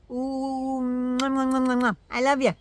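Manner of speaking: high, smacking air kisses; between kisses. Real, not acted. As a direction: blow a string of kisses. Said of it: "blowing kisses to viewers".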